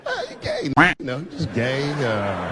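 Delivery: squeaky start, then awkward mumble; mumbling. Retold or imitated. Caught off guard by his friend, awkward mumble. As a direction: mumble it, flustered. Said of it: "I started mumbling again".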